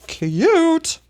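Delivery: usual speaking voice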